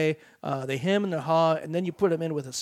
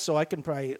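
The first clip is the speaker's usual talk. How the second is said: ordinary delivery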